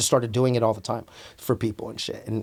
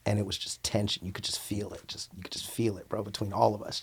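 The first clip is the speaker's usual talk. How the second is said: dropped almost to a whisper; hushed tension; 5 semitones lower, 13 dB quieter than usual. Retold or imitated. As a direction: say it like the room might hear you. Tense classroom, hushed telling.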